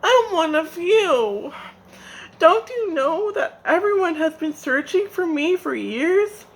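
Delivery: high, loud crying plea; faked sobbing. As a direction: put on tears to fool them. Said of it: "he began to sob".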